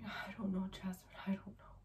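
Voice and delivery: hushed, near-whispered tearful reply; crying, retold softly. Crying husband held back by officers answers his wife, hushed. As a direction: let the voice drop away to breath, imitating him. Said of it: "looks at me crying".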